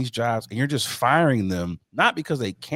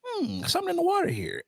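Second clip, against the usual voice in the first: mock suspicious; 5 dB quieter than usual. Mocking Philadelphians.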